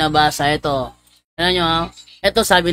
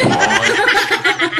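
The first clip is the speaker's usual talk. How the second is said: high laugh; 12 semitones higher than usual. Real, not acted. Preacher laughs at his story.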